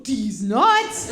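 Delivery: joke done right. Shown as a demonstration. Mom showing son the punchline, loud and sure. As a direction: land it cleanly and punchy. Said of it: "You go like this".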